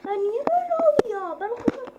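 high, loud exasperated cry; frustrated outburst. Real, not acted. Gamer yelling at a glitching game, exasperated loud cry. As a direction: throw up your hands at the game.

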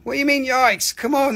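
normal talking voice

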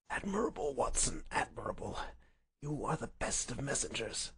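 weak, soft praise with little breath; feeble invalid Holmes. Acted. Sick Holmes praises his messenger, feeble voice. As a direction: praise him faintly but crisply. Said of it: "he spoke in a feeble voice".

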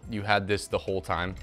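usual speaking voice

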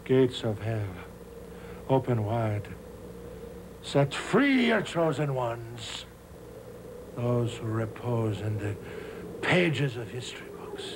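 seance incantation. Acted. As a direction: intone like a medium calling the dead. Medium invoking the spirits.

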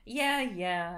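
usual speaking voice

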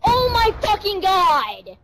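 loud, high frustrated yell; angry yell. Real, not acted. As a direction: scream it out in real frustration. Dying in game, yelling.

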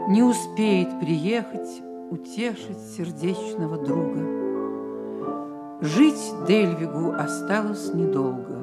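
ordinary delivery

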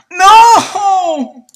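high loud despairing no; loud high cry of no; shout. Real, not acted. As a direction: yell in frustration. Losing the torch.

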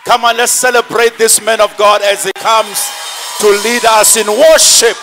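ordinary talk

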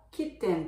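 kitten with a full e, kit-ten; phonics reading. Shown as a demonstration. Child reading while hearing lots of English, phonics reading. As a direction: say kitten as a beginner sounds it out. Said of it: "って呼んでたけれども".

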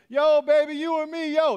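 high, loud catcall; yelling out the window. Retold or imitated. Buddy catcalls a lady from the car, yelling. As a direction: holler it out like a street come-on. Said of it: "yelling out the window".

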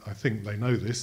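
normal talking voice

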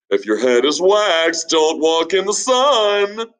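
high, loud know-it-all preaching; high proverb declaiming. Acted. Ben handing out advice to townsfolk, high and loud. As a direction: lecture everyone with sayings.

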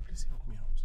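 normal talking voice

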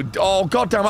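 ordinary delivery

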